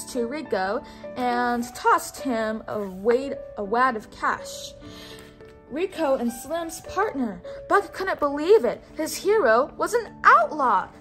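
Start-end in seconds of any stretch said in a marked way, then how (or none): none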